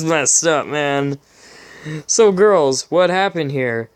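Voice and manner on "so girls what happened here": hammed-up hero. Hero questions the fillies.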